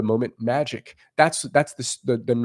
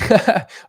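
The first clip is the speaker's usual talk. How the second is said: loud laugh breaking out; burst of laughter; 9 semitones higher, 7 dB louder than usual. Real, not acted. Laughing at his own joke to the camera.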